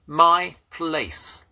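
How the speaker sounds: high, loud, drawn-out words; deliberate. A narrator's reading. Schoolboy writing his essay's first words, deliberate and drawn out. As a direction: spell it out word by word as if writing. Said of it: "I wrote deliberately".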